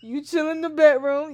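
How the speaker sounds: loud mocking imitation of partner; mocking quote. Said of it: "you literally just said".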